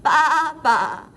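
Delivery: loud, wavering baas; bleating sheep. Acted. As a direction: bleat like a lamb. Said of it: "she makes a sound like this".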